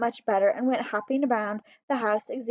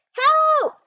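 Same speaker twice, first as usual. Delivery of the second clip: high, loud cry for help; calling for help; 9 dB louder than usual. A narrator's reading. Trapped mouse crying out for help. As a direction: shout it from inside the fridge. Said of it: "he called".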